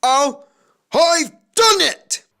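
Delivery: triumphant exhausted. A narrator's reading. Triumphant arrival.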